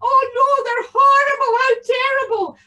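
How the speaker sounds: put-on very high, loud wailing old woman; an old lady pretending to be horrified; mock-distressed old lady. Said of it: "the old lady said".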